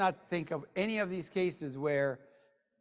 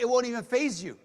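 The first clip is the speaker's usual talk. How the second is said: ordinary talk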